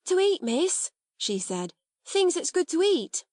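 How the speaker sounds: high, breathless outburst; excited servant girl. A narrator's reading. Becky hearing of food, bursting with excitement. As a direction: blurt it out, thrilled. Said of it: "She broke in with such excitement".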